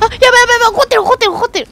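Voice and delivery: high, loud panic as the monster turns; frantic alarm, voice jumping up; startled gamer yelling in alarm; panicked. Real, not acted. Monster gets angry in game.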